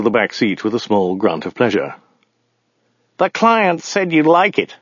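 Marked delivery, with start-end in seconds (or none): 3.2-4.8 high, slow voice for the driver; driver character. A narrator's reading. Driver telling his passenger about the car, high character voice.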